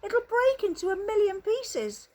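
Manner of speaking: put-on an old man dreading the answer; alarmed shout. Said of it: "shouted Grandpa Joe".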